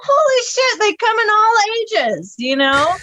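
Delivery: high, astonished exclamation; disbelieving laugh in the voice; amazed disbelief. Retold or imitated. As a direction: marvel that someone so old swore back. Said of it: "I was like".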